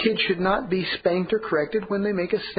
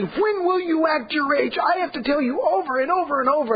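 high, strained scolding father; 8 semitones higher than usual. Retold or imitated. Overheard father nagging his little boy, high strained scold. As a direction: nag the boy like an exasperated dad. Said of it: "overhearing a parent scolding his child".

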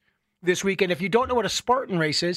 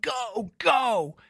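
mock cheering; 5 semitones higher than usual. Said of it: "people are like".

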